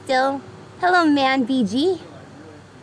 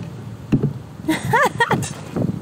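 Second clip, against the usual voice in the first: high, breathy burst of laughter; real laugh; 10 semitones higher than usual. Real, not acted. Streamer amused by a viewer's odd question, laughing. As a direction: crack up with a long, high laugh.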